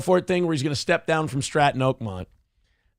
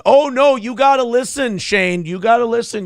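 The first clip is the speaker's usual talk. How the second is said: exasperated correction; 6 semitones higher, 4 dB louder than usual. Real, not acted. Correcting a chatter.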